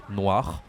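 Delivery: "noir" loud with a wa sound and rolled uvular r; French model word. Shown as a demonstration.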